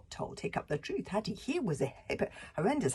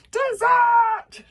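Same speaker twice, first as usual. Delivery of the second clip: very high, very loud dragon shriek; 14 dB louder than usual. Acted. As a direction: screech it like a panicky little dragon. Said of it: "shrieked Toothless".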